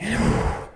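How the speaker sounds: sharp high-pitched sigh; exasperated sigh. Real, not acted. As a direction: let out a frustrated sigh. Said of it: "I'm getting worked up".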